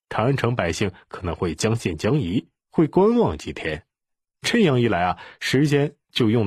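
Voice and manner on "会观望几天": ordinary delivery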